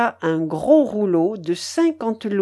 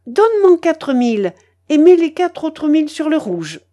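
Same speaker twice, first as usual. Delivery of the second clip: eager command; 7 semitones higher, 6 dB louder than usual. A narrator's reading. Grandmother ordering again.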